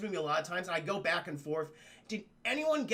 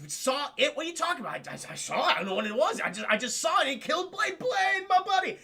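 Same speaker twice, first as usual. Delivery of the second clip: frantic, climbing higher to a loud cry; frantic witness; 9 semitones higher, 4 dB louder than usual. Retold or imitated. Shaken soldier reporting his buddy's death to his commander, frantic. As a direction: blurt it out, pitch rising sharply.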